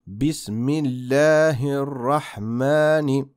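Bismillahirrahmani slowly with a clear ha. Shown as a demonstration. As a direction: make the ha in Bismillahirrahmani heard. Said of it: "La letra ja debería oírse de forma clara".

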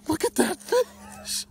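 high, loud, slowed wonder at the catch; real awe building to a shout. Real, not acted. Admiring the big fish.

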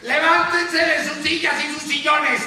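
high, urgent call to action; rally everyone out of their seats; rousing shout. Acted. Anchor urging viewers to their windows, rousing shout.

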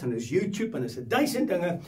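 ordinary talk, nothing marked

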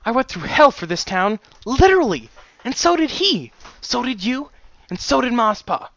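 high, heated, insistent voice; impassioned angry rant. Acted. Daughter telling father what everyone endured, high and angry.

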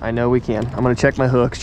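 ordinary delivery

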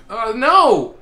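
loud sudden cry of no; alarmed shout. Real, not acted. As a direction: shout in panic. Shark attacks.

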